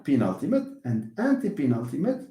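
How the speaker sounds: ordinary delivery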